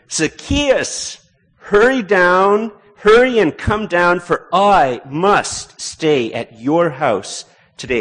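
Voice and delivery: Jesus calling someone down from a tree, high and slow; calling up to him. Acted. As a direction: call Zacchaeus down from the tree, slow and loud.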